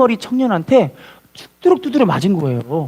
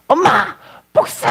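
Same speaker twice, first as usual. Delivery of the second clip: high, acted cry for revenge; vengeful outcry; 6 semitones higher, slower than usual. Wronged son on seeing his mother, vengeful outcry. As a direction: burst out in a higher, anguished voice.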